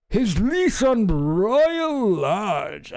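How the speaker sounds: very slow, high posh read; mock royal voice. Rereading the statement line, pompous mock royal. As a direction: drag it out like a stuffy aristocrat.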